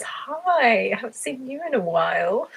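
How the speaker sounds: high, loud delighted hi; excited hi. Real, not acted. Greeting a long-unseen viewer, excited.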